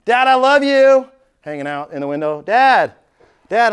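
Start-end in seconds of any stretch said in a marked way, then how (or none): 0.0-1.1 high, loud, drawn-out mock kid's yell; imitated yelling daughter. Daughter hanging out the car window to dad, imitated yell. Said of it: "Why are you yelling at me". 2.4-3.7 loud, raised call imitating a kid; imitated yelling daughter. Daughter calls dad from the window, imitated yell. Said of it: "Why are you yelling at me".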